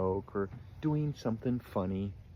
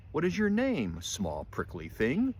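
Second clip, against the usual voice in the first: unkind question; 6 semitones higher than usual. A narrator's reading. Rhinoceros sizing up small Fluffy, unkindly. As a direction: pitch the question up, then drop on the insult. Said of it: "asked the rhinoceros unkindly".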